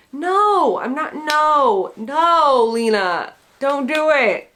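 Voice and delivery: high, loud alarmed pleading; horrified reader begging Lena to stop. Pretend. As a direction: beg a character not to go on.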